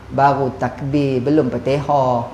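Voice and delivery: husband lazily putting off his wife; lazy brush-off. Retold or imitated. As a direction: brush her off without moving.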